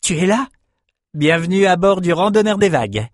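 put-on high, delighted young man's welcome; light up on seeing a friend; eager, high-pitched greeting; beaming. A narrator's reading. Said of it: "le jeune homme rayonna".

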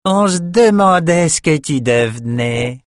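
slow, sneering taunt; nasty sneer. Acted. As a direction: drawl it with a nasty grin. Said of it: "fit Jed avec un rictus mauvais".

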